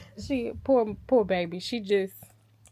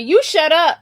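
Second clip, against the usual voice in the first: loud, higher playful snap; mock snap; 6 semitones higher, 11 dB louder than usual. Wife snaps at her teasing husband, loud and playful. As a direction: cut him off, half-joking.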